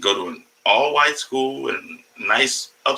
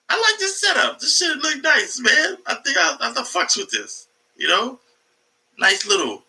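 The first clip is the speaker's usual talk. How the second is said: excited high; 8 semitones higher than usual. Real, not acted. Excited about stream setup.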